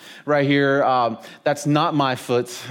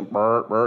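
low croaking "burp, burp" like a bullfrog; bullfrog croak imitation; 5 semitones lower than usual. Pastor imitating bullfrogs for the congregation, low croak. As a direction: croak it out like a frog in the pond. Frog sound scaring the dog.

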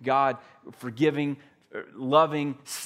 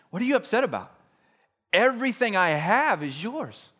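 raised, slowed father's voice; gently pleading father; 6 semitones higher than usual. Retold or imitated. Father pleading with his resentful older son, lightly voiced. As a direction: plead with him like a puzzled dad.

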